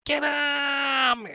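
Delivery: very high, stretched-out shout; goblin battle cry. Acted. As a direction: shriek the order and hold it. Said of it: "He's like".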